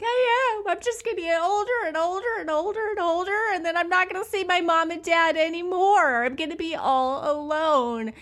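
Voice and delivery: put-on high, fast, wailing child voice; crying little boy. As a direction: imitate a sobbing kid insisting. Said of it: "he's like".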